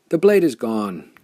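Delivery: a loud, sullen growl of a miner. A narrator's reading. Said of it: "growled a sullen Mechnik".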